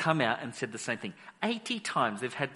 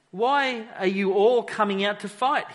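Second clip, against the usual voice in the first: taunting shout; 5 semitones higher, 6 dB louder than usual. Acted. Goliath's taunt read aloud.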